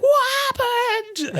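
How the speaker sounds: very high, slow bewildered squeak; mock bewildered squeak. Andrea confused after swapping places, very high slow squeak. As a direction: squeak it out slowly, utterly lost.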